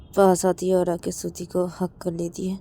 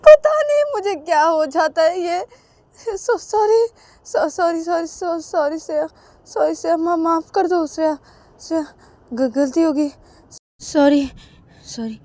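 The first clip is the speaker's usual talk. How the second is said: put-on nervous girl stuttering out sorry; stammering apology; 10 semitones higher, 9 dB louder than usual. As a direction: trip over every word, flustered and guilty. (हकलाती हुई घबराई माफ़ी)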